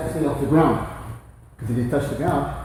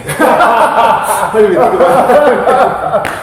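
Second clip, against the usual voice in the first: laughing hard, high and slow; words squeezed out through laughter; 10 semitones higher, 15 dB louder, much slower than usual. Real, not acted. Both laughing at awkward moment.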